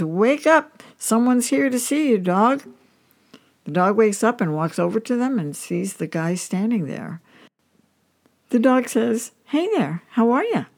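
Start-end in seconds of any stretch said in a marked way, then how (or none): 0.0-2.6 higher, mildly raised mock yell; mild scream. A narrator's reading. Old man calls to sleeping dog, mild yell. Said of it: "bangs on the fence and screams". 9.5-10.6 lightly voiced, higher chirpy greeting; talking dog greeting. A narrator's reading. Dog says hello to the visitor, lightly voiced and higher.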